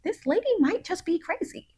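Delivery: put-on high, hushed voice of wary kids; playfully voicing the suspicious kids; kids' wary thought. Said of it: "they just sitting there like".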